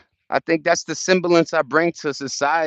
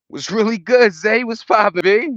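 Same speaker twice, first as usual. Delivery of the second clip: excited greeting; 8 semitones higher, 4 dB louder than usual. Real, not acted. Greeting passerby loudly.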